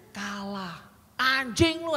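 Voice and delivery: imitating the grandpa's sudden swearing outburst; rude grandpa cursing. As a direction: blurt it out like an angry old man swearing at the football. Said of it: "katanya gitu".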